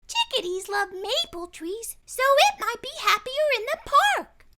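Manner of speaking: put-on high girl's voice, speeding up; lightly voiced helpful girl; offering helpfully. A narrator's reading. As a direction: suggest it brightly. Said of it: "Bridget offered".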